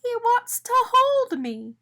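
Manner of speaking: very high, slow and cooing; delighted. A narrator's reading. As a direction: gush over the sweet moment. Arnie delighted being lifted.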